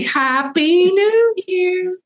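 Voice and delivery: very high, drawn-out whoop of a greeting; climbing, stretched party cheer; celebratory cheer. Real, not acted.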